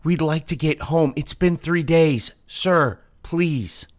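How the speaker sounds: high, urgent begging plea; desperate pleading clerk. Acted. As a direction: beg to be let home. Data clerk pleads to boss.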